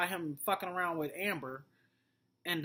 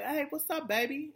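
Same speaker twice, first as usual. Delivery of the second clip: higher, flirty mock greeting; imitating Amber; 10 semitones higher than usual. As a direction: tease it out high and flirty. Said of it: "was like".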